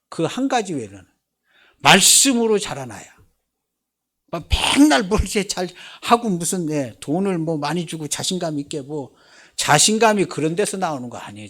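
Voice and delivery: loud, emphatic sermon delivery; forceful preaching. Real, not acted. Preacher telling parents how children must grow, forceful preaching.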